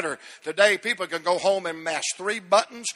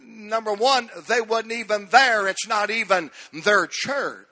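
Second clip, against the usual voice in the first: normal talking voice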